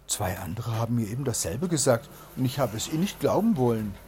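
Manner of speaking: usual speaking voice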